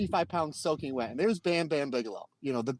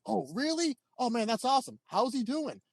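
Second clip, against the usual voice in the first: imitating delight; 9 semitones higher than usual. Bam Bam softens, impressed by Candido.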